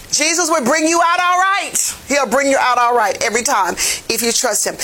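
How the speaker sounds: ordinary talk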